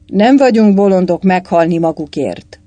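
loud, indignant refusal; shouted. A narrator's reading. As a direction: shout it back in protest. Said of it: "kiáltotta Mikulás szakálló".

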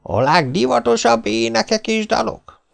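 high-pitched street showman's pitch; hawker's patter. A narrator's reading. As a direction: sell it to the crowd in a high, calling voice. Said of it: "kiáltotta a férfi".

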